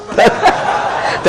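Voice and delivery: high, airy burst of laughter; hearty laugh. Real, not acted. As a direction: laugh out at the joke. Speaker laughs at own joke. (ngguyu ngakak)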